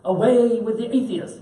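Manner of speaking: high, loud demanding empress voice; imperious empress. Retold or imitated. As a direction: order him to say it, pitched high. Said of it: "finally the empress says".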